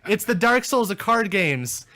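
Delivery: high, loud comic rant; mock outrage. Friend says Dark Souls-esque, player erupts in mock outrage. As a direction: blow up in hammed-up indignation.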